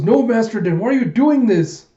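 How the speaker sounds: high, loud wailing question; panicked plea. Retold or imitated. Koyomi pleads with Master Den, high panicked wail. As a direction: plead loudly in acted distress. Girl pleads with her captor.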